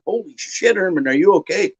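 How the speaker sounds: high, surprised exclamation; retold alarmed reaction; startled. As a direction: react to the shock of the scene. Finding friend fallen in bathroom.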